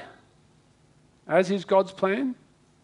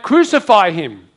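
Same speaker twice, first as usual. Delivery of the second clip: loud, slow, drawn-out shout; crowd's shout; 9 dB louder than usual. Acted. Crowd shouting at Jesus' trial, a loud slow call. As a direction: shout it like the mob.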